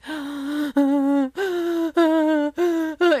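high, drawn-out mock child sobs; imitated sobbing. Imitating daughter after losing a tooth, hammed-up sobbing. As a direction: wail and gasp like a sobbing little kid. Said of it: "she's just sobbing".

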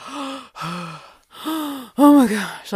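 acted breathless gasp, starting hushed; gasping relief. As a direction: gasp it out as the shock lets go. Both gasping in shock and relief.

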